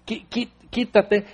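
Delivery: niño que se zafa, tartamudeando lento; niño berrinchudo. Retold or imitated. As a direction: imita a un niño renegando. Niño jalado por la mamá. (niño haciendo berrinche)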